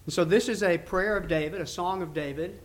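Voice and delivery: usual speaking voice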